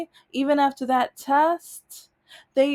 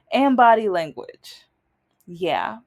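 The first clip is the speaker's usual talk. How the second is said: ordinary talk, nothing marked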